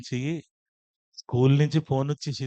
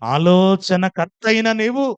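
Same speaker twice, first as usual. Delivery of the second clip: fervent prayer; 10 semitones higher, 10 dB louder than usual. Retold or imitated. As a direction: pray from a murmur up to a full shout. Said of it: "ప్రార్థన చేశాను".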